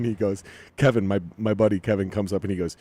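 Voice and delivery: ordinary delivery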